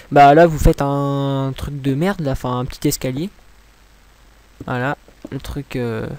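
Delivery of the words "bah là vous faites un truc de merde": usual speaking voice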